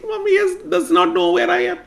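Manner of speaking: very high, fast little voice; giggly child triumph; gleeful child. Acted.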